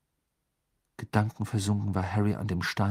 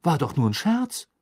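higher, louder incredulous remark; baffled; 7 semitones higher, 5 dB louder than usual. A narrator's reading. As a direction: say it as if unable to believe it. Baffled that the joke worked.

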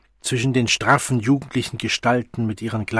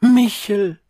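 calling out; 9 semitones higher, 6 dB louder than usual. A narrator's reading. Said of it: "rief sie zur Tür hinaus".

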